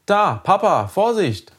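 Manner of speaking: high-pitched alarmed call; sudden warning cry. A narrator's reading. Boy warning his father from the back seat, sudden high cry. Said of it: "schrie Lenny urplötzlich auf".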